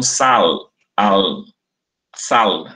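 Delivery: "sal" ending in a clear Spanish-like L; Spanish-style L. Shown as a demonstration. Teacher showing old Minas speakers' L, clear L in sal. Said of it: "com L tipo espanhol".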